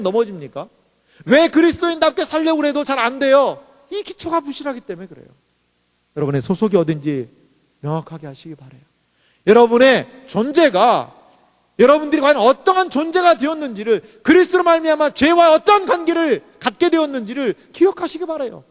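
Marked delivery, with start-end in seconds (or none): 1.2-3.6 loud, high, insistent sermon delivery; full-force preaching. Real, not acted. Pastor driving home to the congregation that reconciliation is given, preaching forcefully. 9.5-18.6 loud, high, insistent sermon delivery; full-force preaching. Real, not acted. Pastor driving home to the congregation that reconciliation is given, preaching forcefully.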